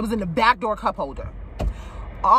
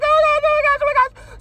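high, a bit louder panicked squeal; retold panic; 14 semitones higher, 3 dB louder, faster than usual. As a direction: freak out at the bug. Bug flies at her again.

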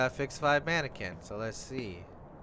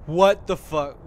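startled; 9 dB louder, slower than usual. Real, not acted. Startled by mannequin.

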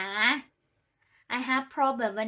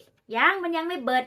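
higher and slow, insisting the beer isn't finished; emphatic retold reply; 6 semitones higher, 4 dB louder than usual. Telling the waiter no more beer yet, higher and slow. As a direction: stretch it out, higher, like it's obvious. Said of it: "ก็เลยว่าจั่งซี้".

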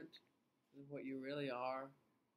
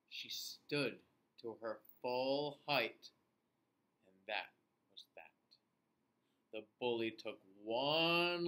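story reading; 5 semitones lower, 6 dB louder than usual. A narrator's reading. Reads story to children.

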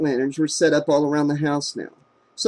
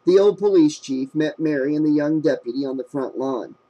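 ordinary delivery, nothing marked

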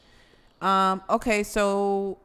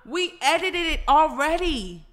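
high, loud fed-up whine; exasperated outburst; 7 semitones higher, 8 dB louder, slower than usual. Real, not acted. Gamer fed up with the client, loud exasperation. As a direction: let the frustration burst out. Client rejects painting again.

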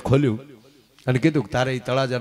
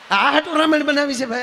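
laughing; 13 semitones higher than usual. Acted. Laughing jab at three mothers-in-law.

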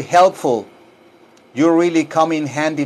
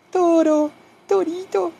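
very high falsetto call; cooing to pet; 13 semitones higher than usual. Real, not acted. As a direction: coo the cat's name in a squeaky voice. Calls his cat. (llamando al gato en falsete)

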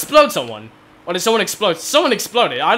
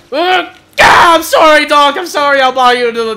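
shouted apology; 7 semitones higher, 9 dB louder than usual. Real, not acted.

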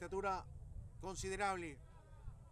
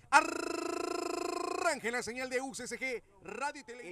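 slow, louder station ID call; announcer voice; 4 semitones higher, 6 dB louder, slower than usual. Acted. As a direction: boom out the station name like an announcer.

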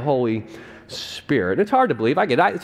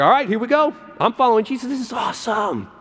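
excited; 7 semitones higher than usual. Retold or imitated. Matthew thrilled to follow Jesus.

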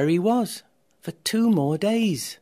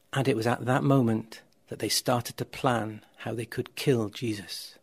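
usual speaking voice

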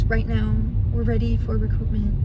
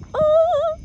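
surprised yelp; 7 dB louder than usual. Real, not acted. Sudden exclamation.

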